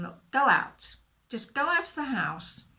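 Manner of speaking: firm order. Acted. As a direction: send the crowd out. Jesus orders the mourners out.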